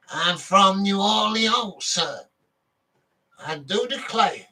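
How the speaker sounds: high, slow Southern drawl, hammed-up; southern gentleman accent. Vlogger to viewers, a playful mock Southern gentleman accent. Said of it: "I could be like a southern gentleman".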